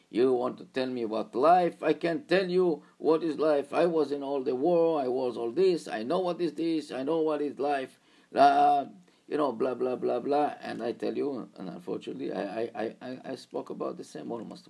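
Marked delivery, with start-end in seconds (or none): 0.1-10.5 louder, higher cocky driver; boastful driver. Retold or imitated. Taxi driver boasts to the student, loud and cocky. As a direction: brag that you know life. Driver lectures about life.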